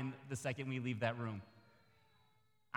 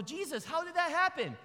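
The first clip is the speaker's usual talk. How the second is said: amazed disciples; 13 semitones higher, 4 dB louder than usual. Retold or imitated. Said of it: "the disciples go".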